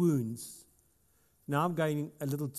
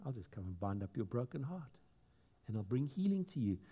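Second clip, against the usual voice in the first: tender reassurance; 3 semitones lower, 7 dB quieter than usual. Retold or imitated. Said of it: "Jesus comes and said".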